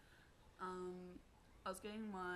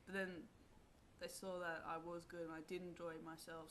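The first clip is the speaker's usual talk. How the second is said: ordinary talk, nothing marked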